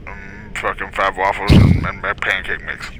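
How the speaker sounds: muffled customer order from the back; mumbled background order. Pretend. Friend pretending to be a mumbling customer, muffled and slow. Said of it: "you should be like".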